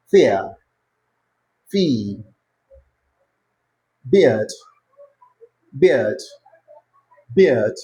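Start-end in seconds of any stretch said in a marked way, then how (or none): 4.1-7.7 "beard" gliding I to schwa; diphthong in beard. Shown as a demonstration. Teacher drilling "beard" against "bead", gliding. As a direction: glide the vowel of "beard". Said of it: "This is".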